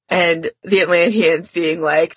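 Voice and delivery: normal talking voice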